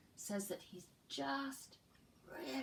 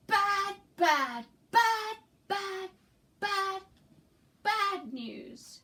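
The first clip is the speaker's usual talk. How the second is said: loud, high, furious yell with a gasp; angry yelling; 10 semitones higher, 10 dB louder, much slower than usual. Acted. Teacher reading the book to the class, yells as the angry character. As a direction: bellow it like a fuming character. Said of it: "Do you think he's yelling".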